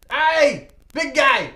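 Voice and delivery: high, loud hail to a friend; calling out. Acted. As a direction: shout it across the tunnel. Character calls to a spotted friend.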